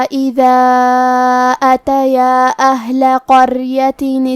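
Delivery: slow melodic Quran chanting with long held vowels; tajweed recitation. Acted. Teacher asking listeners to hear the full recitation with tajweed. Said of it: "سماعت فرمائیں".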